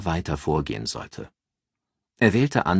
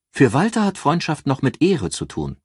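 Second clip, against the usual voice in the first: higher, louder assured female voice; raised voice for a calculating friend giving advice; Ann, cool strategist; 9 semitones higher, 4 dB louder than usual. Acted. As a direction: lay out the plan like a cool strategist. Ann's voice.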